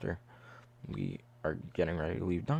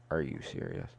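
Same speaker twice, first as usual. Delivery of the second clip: deadpan disbelief; 11 semitones lower, 5 dB quieter than usual. Retold or imitated. Mom shows up at Dunkin'.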